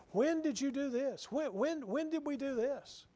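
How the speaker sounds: the humble, stunned by the revelation; shocked disbelief. Retold or imitated. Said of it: "they're shocked at this revelation".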